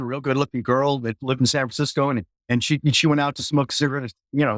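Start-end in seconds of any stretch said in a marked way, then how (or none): none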